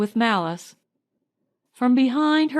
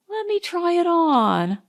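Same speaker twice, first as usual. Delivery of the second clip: light wheedling; 8 semitones higher than usual. Acted. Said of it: "wheedled Marjorie".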